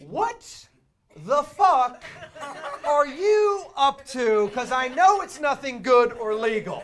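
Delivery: loud, high, disbelieving bank teller laughing through it; incredulous teller. Retold or imitated. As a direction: play the stunned teller yelling what are you up to. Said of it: "the bank teller looks at you like".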